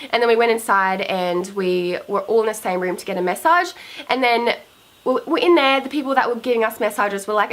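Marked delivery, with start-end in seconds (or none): none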